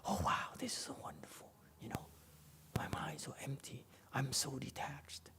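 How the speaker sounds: mocking breathy whisper of smart people; hushed mock enlightened brag; smug whisper. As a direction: whisper like a self-satisfied intellectual. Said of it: "the smart people say".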